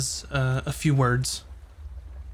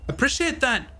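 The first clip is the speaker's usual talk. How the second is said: high-pitched, talkative local backing off; placating chatty local; 10 semitones higher than usual. Acted. Backing off to just making conversation, high-pitched and placating. Character backs off, placating travellers.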